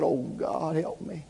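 soft, understated groan of a plea; quiet imitated cry for help; retold moaning prayer. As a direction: pray it weakly, hardly above a murmur. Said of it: "I just moaned and groaned".

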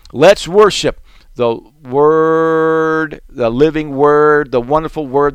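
drawn-out, intoned worship line; slow preaching. Real, not acted. Preacher leading worship, slow intoned exhortation. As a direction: stretch every word reverently.

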